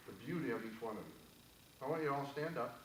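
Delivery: calm, gentle guided-exercise voice; guided meditation. Acted. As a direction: lead the room into stillness, unhurried. Leads audience in guided exercise.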